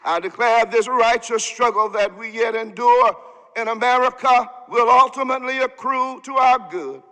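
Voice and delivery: usual speaking voice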